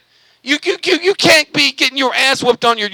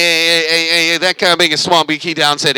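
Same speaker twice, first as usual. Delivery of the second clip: ordinary delivery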